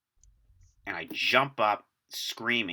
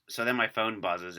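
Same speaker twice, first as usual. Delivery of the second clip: normal talking voice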